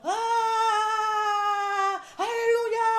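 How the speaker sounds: high, loud, wild cry of release; crazed shout. Acted. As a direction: let it all burst out like a madman. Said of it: "kayak orang gila".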